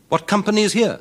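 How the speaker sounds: high, loud astonished call; startled by the crowd; surprised question. Acted. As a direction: champion startled at the wedding guests. Champion surprised at the gathering.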